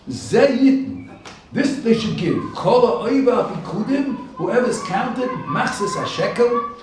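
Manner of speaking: loud, high, measured proclamation; ringing, emphatic recitation and translation of scripture; emphatic preaching. Real, not acted. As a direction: raise the voice and declaim each phrase deliberately.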